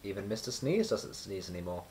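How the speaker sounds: ordinary delivery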